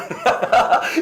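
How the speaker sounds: loud, high laugh; real laughter. Real, not acted. Pastor laughs after his acted insult, amused. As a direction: burst out laughing at your own act.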